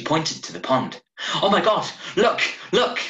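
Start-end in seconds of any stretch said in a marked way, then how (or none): 1.4-3.0 high, loud alarmed cry; shocked cry. Acted. Woman sees arm in pond.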